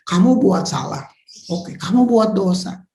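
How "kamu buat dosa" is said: ordinary talk, nothing marked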